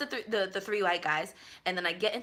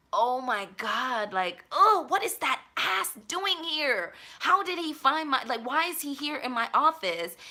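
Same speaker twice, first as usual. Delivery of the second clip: panicked inner rant; 6 semitones higher, slower than usual. Retold or imitated. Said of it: "and like".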